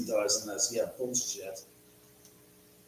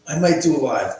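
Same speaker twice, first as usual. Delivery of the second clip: louder, announcing; 8 dB louder than usual. Shown as a demonstration. Deciding to go live for the viewers, louder, stressing might. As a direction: punch MIGHT loud with a falling end. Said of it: "trying the line two ways".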